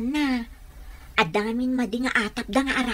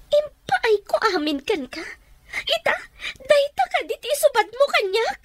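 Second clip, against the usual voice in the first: betrayed crying; 11 semitones higher than usual. Acted. Wife sobbing at her husband who is leaving. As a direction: cry out the betrayal.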